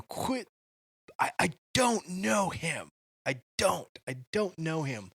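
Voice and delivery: worn-out denial, a touch of despair; exasperated. Retold or imitated. Peter snapping at his accusers, in mild exasperation. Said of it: "an exasperation of".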